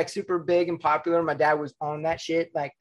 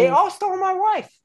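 high squeaky quote; high mock voice; 13 semitones higher than usual. Quoting a movie line.

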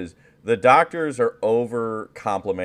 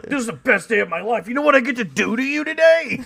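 much higher, giddy gloat; gleeful mock nurse; 12 semitones higher than usual. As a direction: squeal with delight like a villain nurse.

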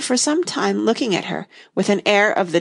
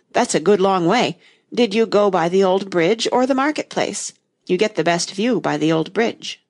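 usual speaking voice

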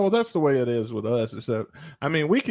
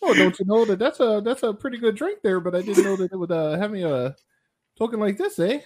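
put-on Canadian accent; 9 semitones higher than usual. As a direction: play a cartoon Canadian, eh. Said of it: "Why am I talking like this all of a sudden?".

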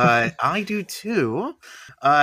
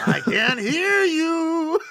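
cartoon captain call; 4 dB louder, much slower than usual. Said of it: "SpongeBob intro call".

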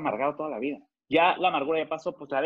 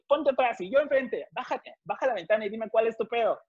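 raised-pitch mock rant, half-hearted yell; hysterical yelling; 7 semitones higher than usual. Retelling his showdown with Karen, a raised hysterical rant. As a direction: play the hysterical enraged neighbor, pitched up. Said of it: "bien histérico".

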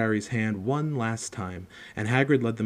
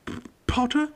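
anxious half-joke; nervous stammer; 13 semitones higher, 7 dB louder than usual. Acted. Quirrell nervous.

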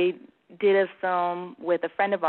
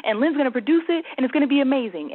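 higher, louder, eager rush of an idea; excited pitch; 7 semitones higher, 4 dB louder, much faster than usual. Retold or imitated. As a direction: sell the plan with growing excitement. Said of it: "And he's like".

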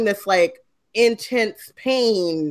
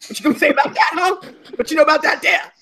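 playful trash talk, pitched up and gloating; mock taunt; 7 semitones higher, faster than usual. Host gloating at absent friend Portia, mock taunting. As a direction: rub it in her face, teasing.

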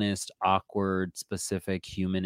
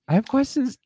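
much higher, exasperated repeat of the line; 4 dB louder than usual. Shown as a demonstration. Critic repeating herself in high mock exasperation. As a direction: squeak it out in disbelief.

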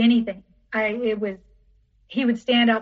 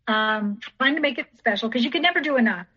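ordinary talk

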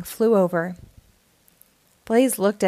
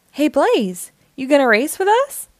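higher, louder, fast friendly hail; eager call; 6 semitones higher, 5 dB louder than usual. A narrator's reading. A falcon invites Blaze to race, calling out eagerly. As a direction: hail a buddy across the sky. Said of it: "asked one of the falcons".

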